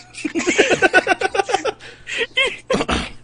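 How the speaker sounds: high laughing burst; loud laughter. Real, not acted. Laughing at own joke.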